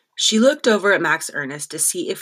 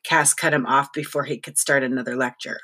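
usual speaking voice

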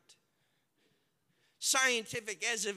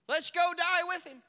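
loud, higher resigned bravado; brave shout; 10 semitones higher, 9 dB louder than usual. Acted. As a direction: throw it out like a dare. Thomas's grim bravado.

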